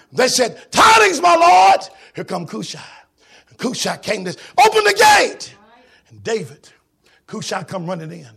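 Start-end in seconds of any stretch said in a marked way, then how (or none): 0.7-1.8 high, loud call for the king; messenger calling out. Acted. 4.6-5.5 high, loud call to open. Acted. Messenger calling at the gate.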